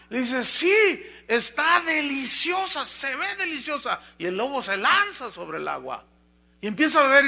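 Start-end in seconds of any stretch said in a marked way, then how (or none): 0.4-4.0 high, drawn-out delight; relishing deer. Acted. Deer tells the wolf above about the water, relishing it. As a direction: savour each word, pitched well up.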